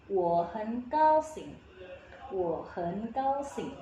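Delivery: slow "wo hen gao xing", "wo" rising, "hen" dipping; tone change demo. Shown as a demonstration. Teacher repeats for learners, slow "wo hen gao xing" with rising "wo". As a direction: model it syllable by syllable with "wo" in second tone. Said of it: "berubah jadi nada kedua".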